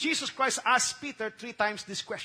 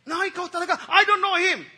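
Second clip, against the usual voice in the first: higher, loud, heated denial; angry denial; 7 semitones higher, 7 dB louder than usual. Acted. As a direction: snap it back, cornered and furious. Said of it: "Nagmura pa".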